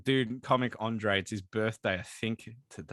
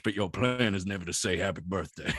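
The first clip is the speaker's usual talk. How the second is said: Arthur Morgan voice; 8 semitones lower than usual. Acted. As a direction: grumble it like a tired outlaw. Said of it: "the Arthur Morgan voice".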